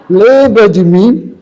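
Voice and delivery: high, loud, drawn-out question; acted hopeful call for recognition, pitch up; eager reintroduction. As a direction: ask it loudly, stretching the words high. Doctor returns, acted greeting.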